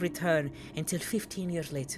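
put-on a light Italian-accented read-aloud; attempted Italian accent. Streamer translating the game's narration, attempted Italian accent. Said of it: "I'm trying to do an accent right now".

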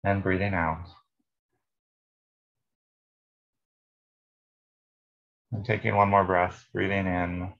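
calm meditation guide, long pauses between phrases; guided meditation. Real, not acted. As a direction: lead listeners unhurriedly through breath and body.

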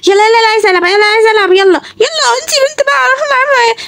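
put-on high, pleading little girl voice begging to play; high child's whine; whiny little girl. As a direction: nag like a bored little girl.

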